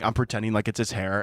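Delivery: ordinary talk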